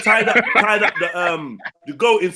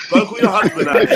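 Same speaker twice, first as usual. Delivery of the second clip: laughing through it. Pretend. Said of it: "and tell your wife".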